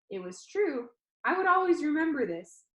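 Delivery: plain reading voice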